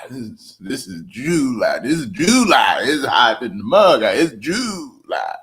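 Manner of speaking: "July" drawn out slowly as JOOO-LIE; hammed-up insistence, "July" in two long syllables; emphatic Mississippi July. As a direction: stretch each "July" with big swoops, hamming it up. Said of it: "it's so hot and humid in Mississippi".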